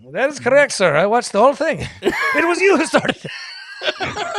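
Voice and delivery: high, loud, emphatic witness; hammed-up high-pitched testimony; imitating cab driver. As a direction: play the driver building to a drawn-out accusation. Cab driver impression accusing the teller.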